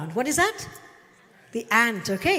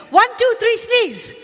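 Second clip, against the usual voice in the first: much higher, louder count; calling out the cue; 12 semitones higher, 10 dB louder than usual. Real, not acted. Teacher cueing the audience's sneeze, loud call. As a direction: belt the count to the whole room.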